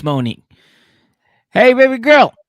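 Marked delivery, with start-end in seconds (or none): 1.5-2.3 P=A; mock smooth boyfriend, high and loud.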